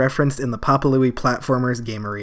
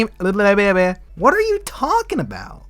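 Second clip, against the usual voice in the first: mock confused squeak. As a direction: squeal the question in disbelief. Repeated confused outburst, very high.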